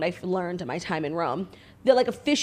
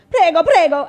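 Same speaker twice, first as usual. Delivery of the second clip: mock Italian shop clerk; 8 semitones higher, 10 dB louder than usual. Shop staff scolding about masks.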